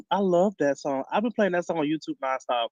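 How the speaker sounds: ordinary talk, nothing marked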